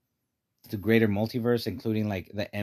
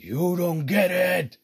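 slow, louder, higher mock angry snap; angry Miguel; 8 semitones higher, 4 dB louder, much slower than usual. Friend imitating Miguel's angry line, slow and loud. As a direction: imitate the furious Spider-Man, slowly. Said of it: "he does sound super angry".

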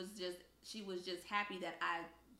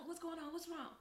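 1 worried mom; 10 semitones higher than usual. Retold or imitated. Sleepy mom worried on phone.

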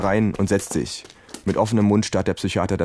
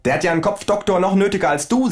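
higher, snide kangaroo; higher cheeky kangaroo jab; mocking kangaroo voice; 12 semitones higher than usual. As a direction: sneer at the shrink. Kangaroo mocks the doctor.

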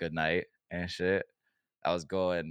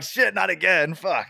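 mock exasperated worker, pitched high and loud; annoyed groan; 11 semitones higher, 8 dB louder, slower than usual. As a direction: whine it like a fed-up clerk. Store workers groaning, acted quote.

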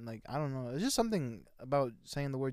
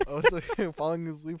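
loud, breaking into laughter; laughing; 4 semitones higher, 12 dB louder than usual. Real, not acted. Teasing co-host about falling asleep, loud and laughing. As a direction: burst out loud, tipping into a laugh.

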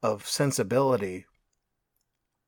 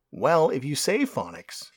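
ordinary talk

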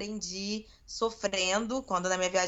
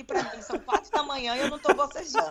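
laughing; 5 semitones higher, faster than usual. Real, not acted. Bragging while laughing.

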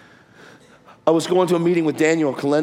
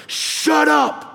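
high-pitched retold order; angry cop; 12 semitones higher than usual. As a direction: imitate a cop cutting someone off. Cop yelling back in retold quarrel.

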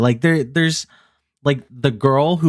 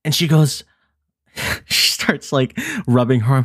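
ordinary delivery

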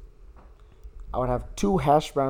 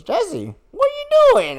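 high, loud, put-on voice calling out a name; high mock greeting; 6 dB louder than usual. Greeting Jesse out of nowhere in a high, loud, put-on voice. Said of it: "and he goes".

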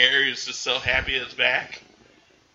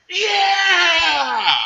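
long, high, loud whoop of joy; joyful yell; 6 dB louder than usual. Retold or imitated. George at the crash site tree, cheering with a high, loud yell. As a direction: throw your arms up and yell it. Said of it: "and he goes".

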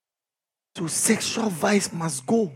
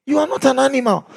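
ordinary talk, nothing marked

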